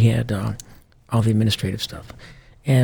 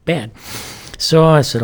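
usual speaking voice

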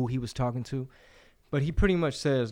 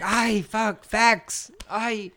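mock pained groan; 9 semitones higher, much slower than usual. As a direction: act stung by the facts. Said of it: "They're like".

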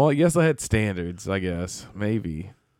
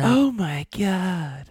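mock movie voice; 7 semitones higher, much slower than usual. Imitating movie character.

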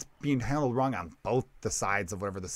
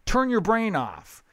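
high, loud, pleading with the crowd; exasperated plea; 12 semitones higher, 5 dB louder than usual. Retold or imitated. Comic begging the audience to listen to the end, high and loud. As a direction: beg them to keep listening, voice up high.

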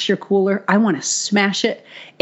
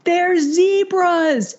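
much higher, slow, wide-eyed mock squeal; giddy squeal; 11 semitones higher, much slower than usual. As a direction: squeal it slowly like an awestruck friend. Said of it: "they're like".

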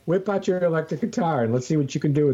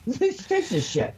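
mock voice; 12 semitones higher, slower than usual.

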